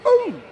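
very high, loud comic yelp of pain; shrill falsetto cartoon ouch; villain's pained yelp. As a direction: squeal like you've just been struck down. Villain is hit.

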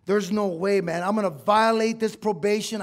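pushing the hopeless thought harder; rising despair. Retold or imitated.

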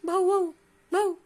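loud, high barking bow wow; teacher imitating a dog's bark. Shown as a demonstration. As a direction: bark it out like a dog for the class. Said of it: "How a dog barks?".

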